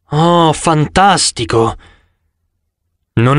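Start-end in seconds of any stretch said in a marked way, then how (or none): none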